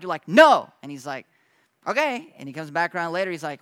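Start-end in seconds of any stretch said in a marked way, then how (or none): 0.3-0.7 sudden loud, higher flat-out no; blunt refusal. Retold or imitated. Refusing God's ride, voiced. 1.8-2.3 much higher, light and unbothered; breezy okay. Retold or imitated. God answering cheerfully, voiced.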